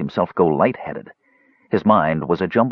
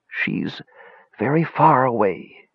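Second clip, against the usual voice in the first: put-on high, sorrowful old wizard; said sadly; 6 semitones higher, slower than usual. A narrator's reading. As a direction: let sadness into the mage's voice. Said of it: "the old wizard said sadly".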